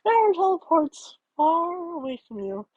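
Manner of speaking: usual speaking voice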